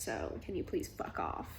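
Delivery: hushed annoyed whisper; breathy whispered brush-off. Real, not acted. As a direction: whisper it under your breath, fed up. Reading, annoyed at book.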